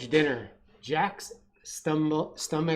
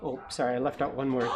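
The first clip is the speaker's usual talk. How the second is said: usual speaking voice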